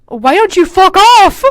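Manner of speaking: loud, high, slow snarl; mock angry. Reading her character's insult, loud and slow. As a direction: belt the insult, hammed up.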